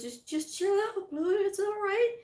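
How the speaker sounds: soothing partner. Retold or imitated. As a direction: settle her nerves kindly. Partner reassuring nervous presenter.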